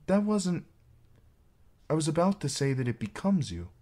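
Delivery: high, halting then hurried; awkward maid. A narrator's reading. Awkward maid compliments the knight.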